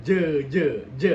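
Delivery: "J" pushed with chest vibration; J bergetar. Shown as a demonstration. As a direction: press out "J" firmly. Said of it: "ada sedikit getaran". ("J" dengan getaran di dada)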